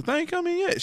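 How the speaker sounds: squeaky high mimic of his wife; mocking her high voice. Quoting his wife's question to the group, squeaky high mimic. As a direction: pitch it way up, needling. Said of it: "And she was all like".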